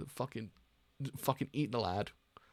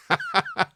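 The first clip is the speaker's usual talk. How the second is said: sudden high-pitched cackle, loud; shrill gleeful laugh bursting out; high villain cackle; 8 dB louder than usual. Acted.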